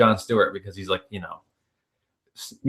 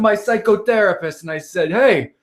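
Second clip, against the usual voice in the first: Andrew Dice Clay; 9 semitones higher than usual. Retold or imitated. Retelling a favorite joke of his, brash comic impression. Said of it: "one of my favorite jokes ever of his".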